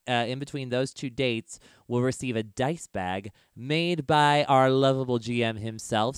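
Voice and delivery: ordinary delivery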